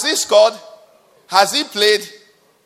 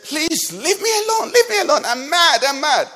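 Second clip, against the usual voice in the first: high, pleading woman's voice, much higher; pleading lady; 8 semitones higher than usual. Retold or imitated. Lady on the plane begging the rude man, high pleading voice. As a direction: beg to be left alone in a high voice.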